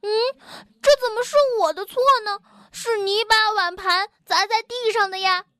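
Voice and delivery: high-pitched, protesting comeback; indignant retort. A narrator's reading. Tiaotiao argues back at Bengbeng, indignant. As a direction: fire the blame straight back. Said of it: "跳跳立刻反驳".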